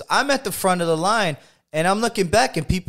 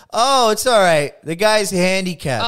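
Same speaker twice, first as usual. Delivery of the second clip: calling out; 5 semitones higher, 6 dB louder than usual. Retold or imitated. Announcing to the waiting line.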